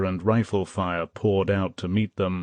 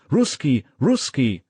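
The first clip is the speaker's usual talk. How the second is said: surrendering plea; 6 semitones higher, 4 dB louder than usual. A narrator's reading. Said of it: "in a token of surrender and said".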